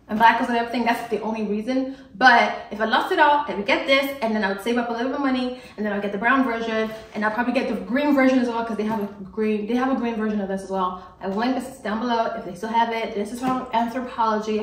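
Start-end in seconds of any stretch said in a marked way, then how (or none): 4.2-11.0 rushed, run-on explanation; fast-talking vlogger getting ahead of herself; talking really fast. Real, not acted. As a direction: rattle it off quickly. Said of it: "I think I'm talking really, really fast".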